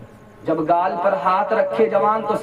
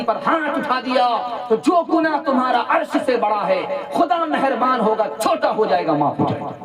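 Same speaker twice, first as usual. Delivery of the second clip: high, raised voice urging him to run to his parents; urgent preaching; 7 semitones higher than usual. Acted. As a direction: press the sinner to hurry home. Prophet's urgent counsel, preached. (ऊँची आवाज़ में जोश भरी नसीहत)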